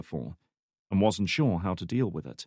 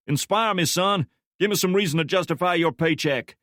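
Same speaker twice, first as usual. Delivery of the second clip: higher, louder demanding boss; stern boss voice; 9 semitones higher, 5 dB louder than usual. Acted. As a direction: press him like a hard boss.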